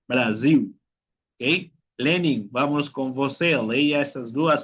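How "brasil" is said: "Brasil" said bra-ziu; final L as U. Shown as a demonstration. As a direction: round the L of "Brasil" into a U. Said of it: "tiene sonido de U". ("Brasil" con L final como U)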